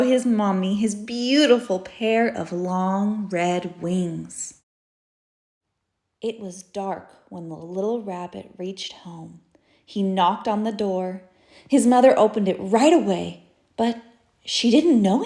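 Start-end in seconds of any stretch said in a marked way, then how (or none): 6.2-9.4 whisper, the night is dark; soft secretive storytelling; hushed whisper. A narrator's reading. Narrator whispering the rabbit home in the dark.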